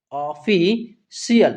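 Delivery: ऑफिशियल very slowly, split as ऑ-फि-शियल; teacher breaking ऑफिशियल into slow syllables; syllable-by-syllable reading. Shown as a demonstration. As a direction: sound out ऑफिशियल in drawn-out pieces. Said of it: "तो इस तरीके से क्या पढ़ेंगे".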